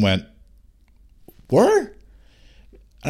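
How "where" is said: high, loud yelp of disbelief; incredulous. Retold or imitated. Lost lad to farmer, incredulous. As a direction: shoot the pitch right up, astonished. Stunned reply to farmer's directions.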